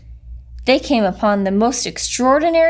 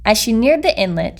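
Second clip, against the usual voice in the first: ordinary delivery, nothing marked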